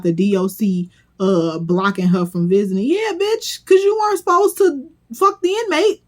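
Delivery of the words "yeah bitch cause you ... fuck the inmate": high-pitched, laughing disbelief; scandalised, pitched way up; incredulous scolding. Real, not acted. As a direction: squeal it as if it's absurdly obvious. Mocking her complaint, incredulous.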